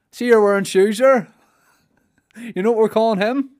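high, loud teasing workmate. Retold or imitated. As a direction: rib the new guy loudly about his shoes. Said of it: "naming Scotty scene".

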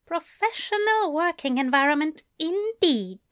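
ordinary delivery

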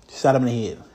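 ordinary talk, nothing marked

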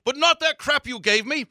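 put-on slow, loud, high South African accent. Rocker remembering his host's awful shot, imitated South African accent. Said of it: "thick, like, you know, South African accent going".

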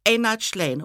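normal talking voice

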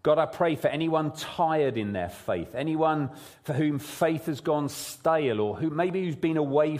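prayer. Real, not acted. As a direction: pray it gently and sincerely. Pastor prays aloud before sermon.

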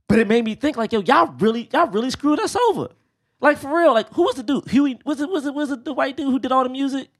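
high, worked-up rant; high worked-up rant at Disney. Real, not acted. Ranting at Disney, pitch high.

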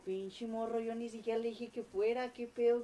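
high-pitched, increasingly loud protest; acted indignation. Storyteller reacting to the angry message, indignant.

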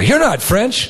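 high scoffing Frenchman voice. Retold or imitated.

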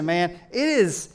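ordinary delivery

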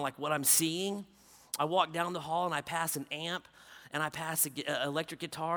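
ordinary delivery

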